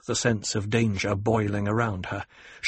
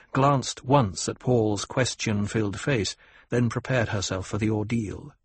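ordinary delivery

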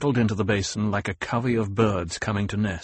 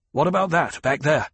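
higher-pitched urgent question; urgent interruption; 6 semitones higher than usual. A narrator's reading. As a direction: cut in, voice lifted. Paul cuts in, pointing.

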